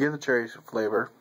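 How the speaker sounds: talking around a pipe. Real, not acted. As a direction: pipe stem between teeth. Seen 0: Pipe stem in mouth early; held across face mid-phrase, then back.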